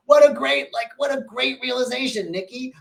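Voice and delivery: high, bright burst of delight; light up at the viewer's insight; praising a viewer who just had a breakthrough; delighted praise. Real, not acted. Delighted reaction to viewer comment.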